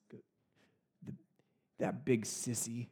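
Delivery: put-on slow, whispered sulky little boy; a scared child muttering under his breath; shaky little voice. Boy grumbles about dad after thunderstorm, shaky whisper. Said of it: "then his shaky little voice".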